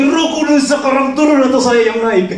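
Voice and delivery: high, loud threatening shout upward; exasperated shout. Acted. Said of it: "dia teriak lagi".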